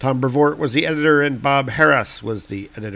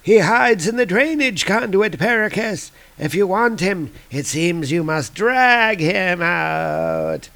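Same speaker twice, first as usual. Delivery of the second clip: higher, sly villainess voice; 6 semitones higher, slower than usual. Acted. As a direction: tease the villain about his prey. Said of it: "And Zelia's like".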